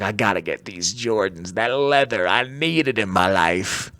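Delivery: low, mock-dramatic put-on voice; hammed-up craving. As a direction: drop deep and overplay the longing.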